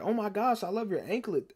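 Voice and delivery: high-pitched mimicked compliment; imitated gushing girl. Classmate complimenting a girl's anklet, imitated high gushing. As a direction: lift the pitch, gush like her.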